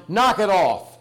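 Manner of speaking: imitating a stern God yelling down; mock yell. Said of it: "he yells down".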